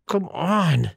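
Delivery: loud, high exasperated cry; frustrated outburst. A narrator's reading. Alone in the cemetery, cursing the absent reapers, frustrated outburst. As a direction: snap it out in frustration. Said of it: "muttered under my breath".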